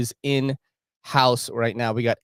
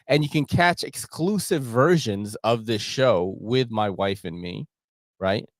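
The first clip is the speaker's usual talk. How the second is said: normal talking voice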